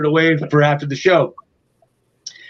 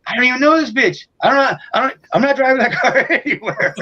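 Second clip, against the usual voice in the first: indignant protest; 8 semitones higher, faster than usual. Retold or imitated. Singer refusing cops' request.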